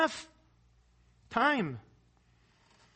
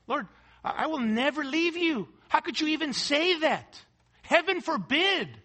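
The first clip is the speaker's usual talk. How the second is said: raised-pitch, fervent acted pledge; indignant protest; 6 semitones higher, 4 dB louder than usual. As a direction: protest your loyalty as if shocked at the suggestion. Voicing Peter's indignant pledge of loyalty.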